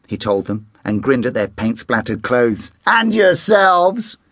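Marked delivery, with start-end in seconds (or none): 2.9-4.2 high, slow teasing punchline; playful teasing. A narrator's reading. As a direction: tease them about the mess. Dad teasing about paint.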